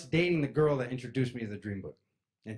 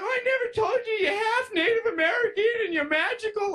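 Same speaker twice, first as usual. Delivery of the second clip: high laughing mom; 8 dB louder than usual. Retold or imitated. Mom quoted, laughing falsetto.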